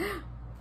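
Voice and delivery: imitated startled gasp; gasp on waking. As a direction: gasp as if jolted awake. Mimes waking up from nightmare.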